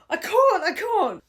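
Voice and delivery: high, loud mock wail; panicked friend. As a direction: imitate a friend in a helpless panic. Panicked friend acted in story.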